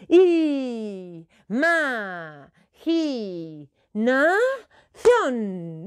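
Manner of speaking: long drawn-out shout. Shown as a demonstration. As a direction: yell it out long and loud. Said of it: "quiero oírte gritando bien alto". (gritando bien alto)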